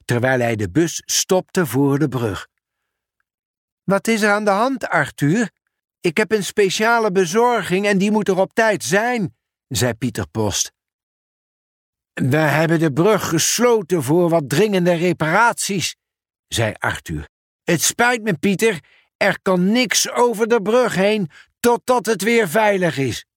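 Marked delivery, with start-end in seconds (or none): 3.8-9.4 raised, quick worried postman; hurried Pieter. Acted. Pieter asks Arthur about the bridge, hurried. 12.1-23.3 raised, regretful workman; apologetic Arthur. Acted. Arthur apologizes to Pieter about the bridge, regretful.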